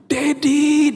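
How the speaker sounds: high drawn-out shout for daddy; child calling out to dad in delight; excited shout. Retold or imitated. Daughter spotting the sold sticker, excited high shout. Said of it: "dia teriak".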